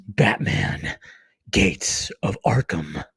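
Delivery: low, slow trailer title reveal; movie trailer voice. Shown as a demonstration. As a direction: drop low and draw out the title like a trailer announcer. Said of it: "It's my movie voice".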